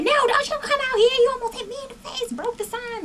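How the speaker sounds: imitating a high-pitched freak-out. Retelling how Tanisha freaked out over the thrown shoe, high mock outrage. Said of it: "cursing around, freaking out".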